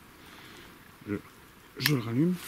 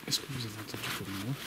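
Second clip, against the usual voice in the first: hushed; 8 dB quieter than usual. Real, not acted. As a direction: ask quietly, unsettled. Asking after strange noise. (à voix basse)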